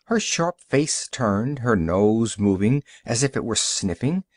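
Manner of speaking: usual speaking voice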